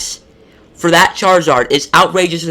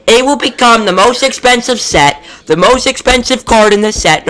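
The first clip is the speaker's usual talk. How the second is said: raised, higher, louder frustrated ranting; 6 semitones higher, 5 dB louder than usual. Real, not acted. Collector ranting at Pokemon about too many Charizards, heated and loud. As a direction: vent at Pokemon like you're fed up. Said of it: "This stuff's pissing me off".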